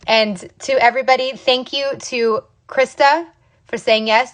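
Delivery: usual speaking voice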